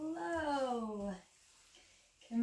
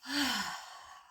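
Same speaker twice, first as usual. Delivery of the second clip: sigh; 2 semitones higher than usual. Shown as a demonstration. Breathing exercise, keep sighing.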